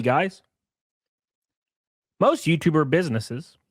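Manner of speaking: normal talking voice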